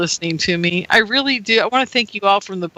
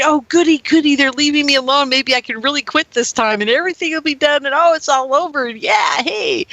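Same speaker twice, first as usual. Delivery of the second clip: bubbling over at the thought of quitting; giddy self-quote, tumbling out fast; excited giddy relief; 6 semitones higher than usual. Retold or imitated. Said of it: "I was so excited".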